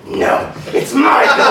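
slow, high shouted correction; forceful insistence. Retold or imitated. Haunt actor overrules the woman's name, shouted insistence.

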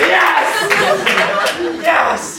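high, gleeful exclaiming; triumphant cry. Retold or imitated. Haunt actor as twenty heads turn, gleeful triumph. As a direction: crow in triumph.

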